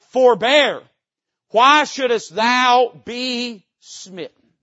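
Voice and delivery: loud, slow threat from the king; a king's warning, loud and slow; menacing king. Retold or imitated. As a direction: lean in and threaten, drawing it out. Preacher voices king's threat.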